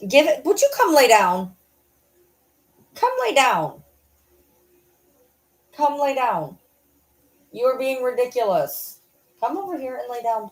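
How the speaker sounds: coaxing the dog. Real, not acted. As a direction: call the dog to come lie down, sweetly.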